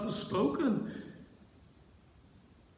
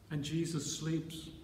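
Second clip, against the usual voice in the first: ordinary delivery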